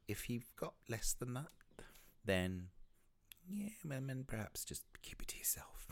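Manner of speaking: high teasing aside into a whisper; cheeky. Real, not acted. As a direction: tease them, then drop to a whisper. Cheeky joke about bad reviews.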